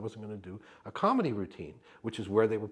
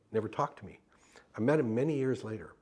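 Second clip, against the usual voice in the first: normal talking voice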